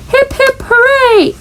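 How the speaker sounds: high, happy cheer. A narrator's reading. The class cheers for Froggy, cheering. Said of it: "everybody cheered".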